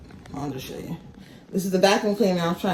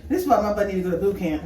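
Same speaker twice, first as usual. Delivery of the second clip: ordinary talk, nothing marked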